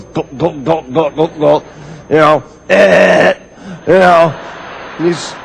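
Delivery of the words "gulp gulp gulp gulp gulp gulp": low mock gulps of beer; softer, lower gulping sounds; gulping noises. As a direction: mime chugging a can, gulp by gulp. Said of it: "He goes".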